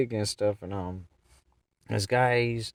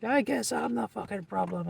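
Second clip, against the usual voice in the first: high, put-on whine of protest; mock the man in a high voice; aggrieved man. Raging man answers the driver, aggrieved. Acts out the raging man's reply.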